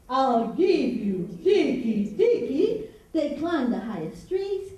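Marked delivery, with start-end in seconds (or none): 0.1-2.9 slow, loud, stretched-out roar; lion roar. Shown as a demonstration. Storyteller shows audience Lion's threat, slow loud roar. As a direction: roar each word out slowly like a lion. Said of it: "like a lion".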